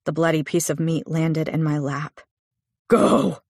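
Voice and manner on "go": furious roar, higher and louder; a violent husband's sudden roar. Acted. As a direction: bellow the command in rage. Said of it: "he roared".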